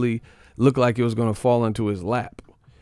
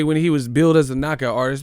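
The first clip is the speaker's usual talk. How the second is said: ordinary talk, nothing marked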